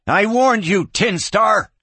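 high, loud angry holler. A narrator's reading. Kid to the marshal as customers dive for cover, hollering. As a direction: holler a last warning. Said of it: "the kid hollered".

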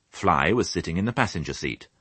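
usual speaking voice

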